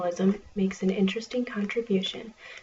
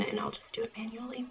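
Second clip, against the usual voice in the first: library whisper; 7 dB quieter than usual. Acted.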